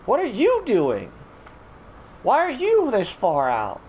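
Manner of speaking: high, loud, drawn-out scolding; exasperated yelling. Acted. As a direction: shout at the straying villagers. Scolding wandering villagers in game.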